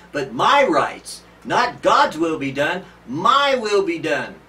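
slow and loud, leaning on every "my"; slow emphatic preaching. Acted. Preacher contrasting God's will with self-will, slow and loud. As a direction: hammer each "my" home, slowly.

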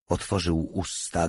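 ordinary delivery

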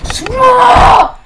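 high, very loud outburst; real full-force yell at the game; frustrated scream. Real, not acted. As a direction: scream it out, stretched long. Outburst at failing to join.

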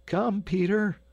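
high-pitched hurry-up call. A narrator's reading. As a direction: call him over from a distance, pitched well up. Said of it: "cried Lambert from the line".

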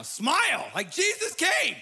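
high, loud, beaming call to smile; joyful shout. Real, not acted. Pastor rallying a glum congregation, loud joyful exhortation. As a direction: lift the room with a bright shout.

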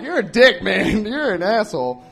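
loud laughing insult. Retold or imitated. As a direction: scold him through laughter. Scolding friend while laughing.

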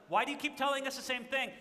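mock exasperation; annoyed disciples. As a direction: complain like a fed-up student.

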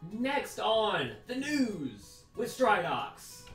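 slow announcer intro; drawn-out newscaster opening; parody news anchor. Pretend. Comedic fake news show intro.

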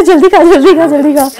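mock shopkeeper's rush, broken by laughter; laughing imitation. Retelling the rushing shop guy to family, laughing through it. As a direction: repeat the bossy order while cracking up. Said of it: "ऐसा करो".

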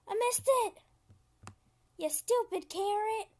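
high, loud wail of frustration; frustrated cry. Real, not acted. Player scolding a missed carrot, frustrated high cry. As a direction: cry out at the near miss.

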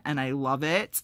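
ordinary talk

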